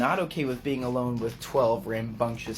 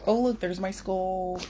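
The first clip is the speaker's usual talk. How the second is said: put-on voice; 7 semitones higher than usual. High aside in put-on voice.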